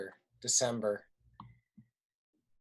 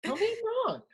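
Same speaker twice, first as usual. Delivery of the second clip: much higher, playful dare; teasing challenge. Acted. Friend dares roommate to disagree, teasing challenge. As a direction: dare them to disagree, pitch way up.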